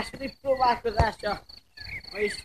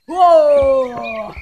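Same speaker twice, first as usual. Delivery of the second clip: excited; 2 semitones higher, 13 dB louder than usual. Real, not acted. Penalty shot, excited reaction.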